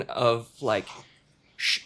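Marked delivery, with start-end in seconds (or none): 1.5-1.8 high, rough Liverpool Cockney bark; imitating a Liverpool Cockney lad; Liverpool Cockney accent. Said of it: "kind of Liverpool Cockney accent".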